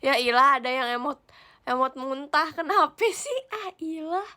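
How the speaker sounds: loud, exasperated groan; fed up with the vomit emoji, loud exasperation. Real, not acted.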